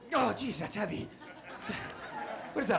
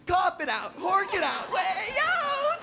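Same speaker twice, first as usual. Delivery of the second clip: cheering crowd; 7 semitones higher, slower than usual. Retold or imitated. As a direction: cheer him on, hork it out. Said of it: "people lined up going".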